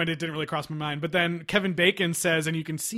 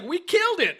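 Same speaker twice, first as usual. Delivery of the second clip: excited quote; 12 semitones higher than usual. Retold or imitated. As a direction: chirp it like a gleeful win. Quoting Bacon's excited line.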